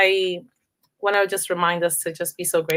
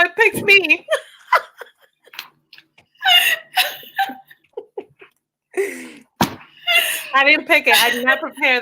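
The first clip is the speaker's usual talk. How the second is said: laughing and teary; 9 semitones higher than usual. Real, not acted. Guest laughing, moved, about poem.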